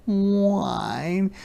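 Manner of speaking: high, drawn-out sad whine; high-pitched lonely puppy whine; whining wolf cub. Acted. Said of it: "wolf cub is feeling very sad".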